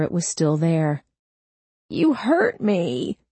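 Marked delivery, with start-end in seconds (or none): 1.9-3.2 whining. Acted. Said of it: "he whined".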